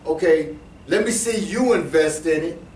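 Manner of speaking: usual speaking voice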